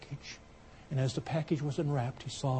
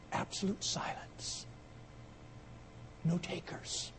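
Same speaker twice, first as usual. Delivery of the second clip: slow, breathy hush for the silence; slow whispered pause; 4 semitones higher, 10 dB quieter, slower than usual. A narrator's reading. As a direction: whisper it to let the room go still. Hushed telling of silent auction room.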